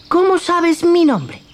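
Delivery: put-on high, childlike wooden puppet voice; Pinocchio's voice. Pinocchio coaxes the cricket toward the theatre, high childlike voice.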